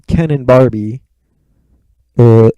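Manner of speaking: sudden loud burst; too close to the mic, booming; blowing out the mic. Real, not acted. Podcaster booming into his own mic. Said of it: "blowing out the mic again like an idiot".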